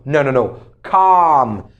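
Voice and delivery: ordinary talk, nothing marked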